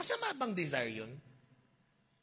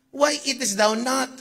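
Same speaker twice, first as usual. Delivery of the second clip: pleading question; 5 semitones higher, 10 dB louder than usual. Acted. Reads Elkanah's plea.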